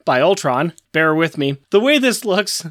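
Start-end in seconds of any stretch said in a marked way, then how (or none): none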